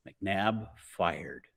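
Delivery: usual speaking voice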